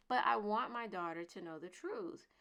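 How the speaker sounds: ordinary delivery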